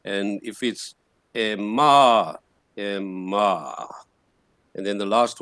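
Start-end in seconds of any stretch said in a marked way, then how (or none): 1.4-2.4 "ema" with a loud, swelling "ma"; stressed long vowel. Shown as a demonstration. Teacher demonstrating accent marks, "ema" with loud long "ma". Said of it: "So when you pronounce". 2.8-3.9 "ema" low, sinking on a long "ma"; falling tone. Shown as a demonstration. Teacher demonstrating accent marks, "ema" low and falling. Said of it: "So when you pronounce".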